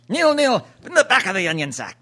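high, mock British football-commentator call; playful British goal call; British accent. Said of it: "my British accent".

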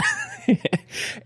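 sudden high laugh; high burst of laughter. Real, not acted. Laughs at own joke.

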